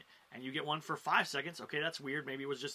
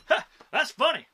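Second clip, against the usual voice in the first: loud, high, punchy single "ha"; mock guffaw remark; 9 semitones higher, 12 dB louder than usual. Comic imitating a watching audience member, loud mock amusement. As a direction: imitate a guy who announces it's funny instead of laughing. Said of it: "instead of laughing, he goes".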